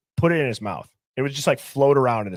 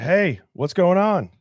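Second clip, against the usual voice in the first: higher, louder, drawn-out hallway hello; imitated coach greeting; 5 semitones higher, 5 dB louder, slower than usual. Coach greeting player in long hallway, called out. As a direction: call it out slowly, pitched up.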